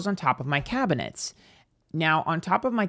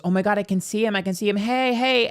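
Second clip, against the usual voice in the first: higher and louder, thrilled to spot it; excited discovery; 6 semitones higher, 3 dB louder than usual. Retold or imitated. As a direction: call out to the bird in delight. Maintenance worker spotting the bird.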